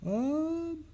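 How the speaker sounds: talking through a yawn. Real, not acted. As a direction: yawn stretching the words out. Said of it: "excuse me for yawning".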